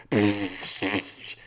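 wet raspberry blown through the lips; imitated spitting noise. Dad mimicking his daughter's new bad habit, spitting noise. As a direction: spit noisily with no words. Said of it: "making these weird noises with her mouth".

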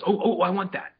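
high-pitched pleading as a grabby pup; excited little-dog voice, pitched high; eager little dog. Acted. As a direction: pipe up as the little dog wanting the toy. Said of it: "the little one goes".

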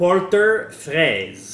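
"fraise" said the French way with a throaty r; slow "Porter fraise" in French; French pronunciation. Shown as a demonstration. Host reads a French beer name aloud slowly. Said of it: "reads French beer name".

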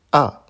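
A short, loud, falling from high; fourth tone on A. Shown as a demonstration. Teacher showing learners Mandarin A, falling tone. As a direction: drop A sharply from the top. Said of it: "Je les répète".

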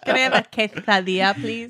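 lisped Castilian Spanish pronunciation; Spanish accent. Acted. Ordering food as if from Spain, Spanish accent. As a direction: order it with a Spain-style lisp. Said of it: "Like you're from Spain?".

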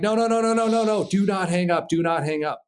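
high, rapid-fire string of no's, then firm; re-enacted frantic plea; urgent pleading. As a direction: plead before the line goes dead. Begs him not to hang up.

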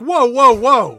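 loud, high whoa; alarmed stop. Real, not acted. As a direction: pull the brakes on the story. Stopping a racist line.

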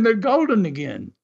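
ordinary talk

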